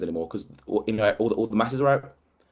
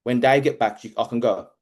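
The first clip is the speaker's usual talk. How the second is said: ordinary delivery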